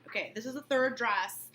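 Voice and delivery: ordinary delivery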